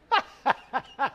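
short high burst of laughter. Real, not acted. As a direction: laugh at the silliness. Laughing at his own story.

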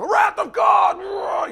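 huge high slow shouted wrath; mock fire-and-brimstone preacher. Mocking TV preachers with a big booming wrath call. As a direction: ham up a TV doomsday preacher.

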